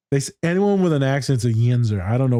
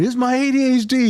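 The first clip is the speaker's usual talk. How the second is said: much higher, mock whiny excuse; student blaming ADHD, pitched up and whiny; excuse-making client; 11 semitones higher, 3 dB louder than usual. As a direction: whine the excuse in a high voice.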